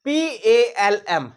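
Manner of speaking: letters spelled very slowly and loudly; slow spelling. Shown as a demonstration. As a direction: spell पी ए एल एम out deliberately. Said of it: "जैसे आपको देखो".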